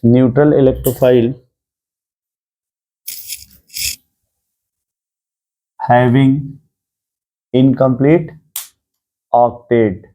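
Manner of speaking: word by word with long pauses; note dictation. Shown as a demonstration. Teacher repeating the note for students, measured dictation. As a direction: repeat it piece by piece for note-takers.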